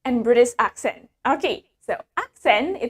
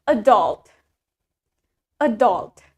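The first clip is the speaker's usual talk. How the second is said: "adult" as a-DULT, low and falling; American stress on second syllable; 4 semitones lower, slower than usual. Shown as a demonstration. Teacher leading students to repeat American "adult", second syllable stressed. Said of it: "is in the second syllable".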